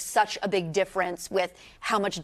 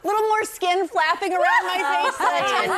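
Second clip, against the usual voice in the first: laughing; 10 semitones higher than usual. Real, not acted. Laughing at old footage.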